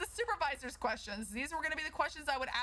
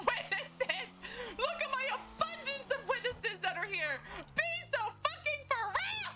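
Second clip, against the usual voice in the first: much higher, laughing mock outrage; laughing sarcasm; 11 semitones higher than usual. Mocking the missing witnesses, laughing and sarcastic. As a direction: cackle through a sarcastic rant.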